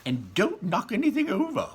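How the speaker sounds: high, loud snap of a warning; shouted warning. Acted. Wonka warning the children off, high and loud. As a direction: shout it to stop grabbing hands. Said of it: "shouted Mister Wonka".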